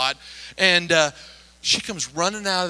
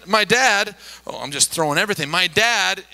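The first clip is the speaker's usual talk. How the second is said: ordinary delivery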